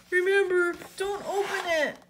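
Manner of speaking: high, drawn-out call; SpongeBob. A narrator's reading. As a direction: shout a warning after someone leaving. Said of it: "SpongeBob shouted after him".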